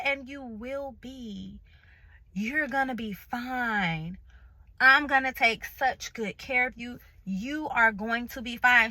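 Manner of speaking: slow, soft reassuring promise; reassuring nurse. Retold or imitated. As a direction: comfort her, gently and slowly. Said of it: "she reassured me".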